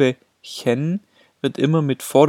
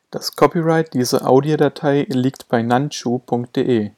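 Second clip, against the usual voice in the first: ordinary delivery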